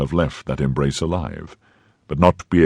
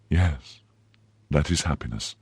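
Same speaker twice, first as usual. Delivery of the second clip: mechanically; 7 dB quieter than usual. A narrator's reading. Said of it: "Milady replied mechanically".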